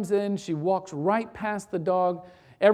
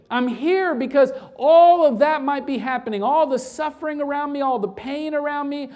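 raised, emphatic preacher's voice; impassioned preaching; 4 semitones higher, 4 dB louder than usual. Real, not acted. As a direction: drive each repeated phrase home to the congregation. Preaching at rising force.

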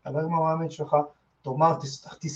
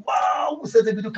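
excited; 5 semitones higher, 5 dB louder than usual. Retold or imitated. Retelling jumping up during fight.

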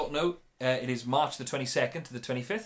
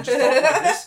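mock American accent, pitched high with a laugh; 13 semitones higher, 14 dB louder than usual. Showing how he turns to the cameraman, in an American accent. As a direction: jump into a higher, louder American imitation. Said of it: "it's all back to American".

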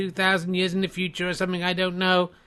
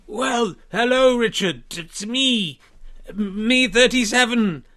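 Boris Johnson impression; 5 semitones higher than usual. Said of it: "I thought it was Boris Johnson".

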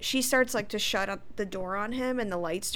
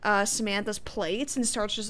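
ordinary talk, nothing marked

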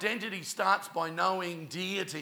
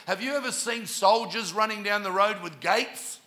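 ordinary talk

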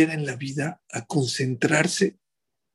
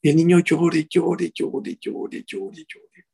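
wailing llore climbing higher, then fading to a breathless whisper; mock child wailing; 4 semitones higher, 3 dB louder, slower than usual. Rabbi imitating the scrubbed child's crying, rising then fading.

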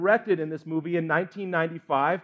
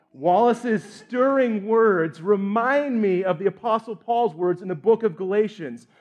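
ordinary talk

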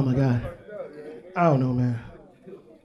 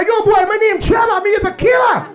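high yelling Jamaican patois imitation; fake Jamaican accent; 6 semitones higher than usual. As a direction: bark it like a club guy faking Jamaican. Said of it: "you hear that one guy".

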